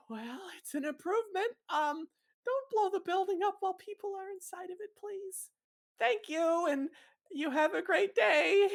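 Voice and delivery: very high, meek voice; timid polite Hap. Acted. Hap asking the bomber to spare people, timid. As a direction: squeeze out a nervous, polite goodbye.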